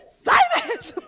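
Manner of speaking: high, loud comic yell; joking shouted agreement. Retold or imitated. Mum joining in her daughter's rebuke, comic shouting. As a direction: shout it breaking into laughter. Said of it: "Aí eu falei".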